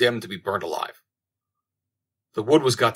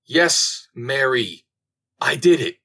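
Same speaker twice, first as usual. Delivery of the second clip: high, loud cry of confession; 4 semitones higher, 5 dB louder, much slower than usual. A narrator's reading. Repentant queen confessing to Mary at the stake, crying out loudly. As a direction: cry it out, raised in pitch and volume. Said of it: "she cried out loudly".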